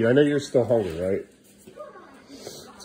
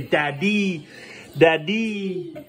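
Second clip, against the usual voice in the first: "daddy" drawn out, syllable by syllable; teaching a word; 4 semitones higher, much slower than usual. Shown as a demonstration. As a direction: say "daddy" slowly for a toddler to copy. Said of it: "Can you say daddy?".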